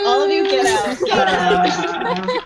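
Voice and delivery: loud laughing mock outrage; mock get out. Host shooing teasing friends, loud mock outrage. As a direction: shoo them all out, laughing.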